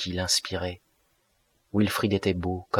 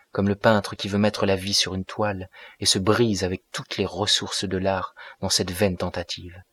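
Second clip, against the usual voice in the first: ordinary delivery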